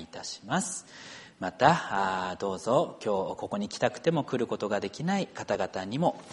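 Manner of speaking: soft, low-pitched congregational prayer. Real, not acted. Pastor asking God to bless and guide the congregation, softly praying. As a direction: pray quietly and reverently, phrase by phrase.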